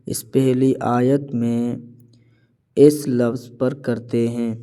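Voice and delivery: ordinary delivery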